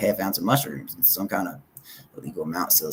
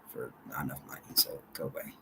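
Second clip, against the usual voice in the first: whisper; 6 semitones lower, 12 dB quieter than usual. Real, not acted. Mutters a brush-off to someone.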